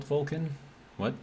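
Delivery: "Vulcan?" jumps up high in sudden disbelief; a startled, rising "Vulcan?" as it dawns on you; surprised. Real, not acted. Streamer spotting an unexpected planet, asking "Vulcan?" high and startled.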